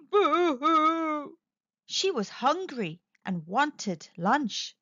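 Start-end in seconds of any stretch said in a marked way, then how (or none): 0.1-1.4 higher-pitched storybook boo-hoo; mock crying. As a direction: wail a pretend boo-hoo. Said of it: "started to cry big tears".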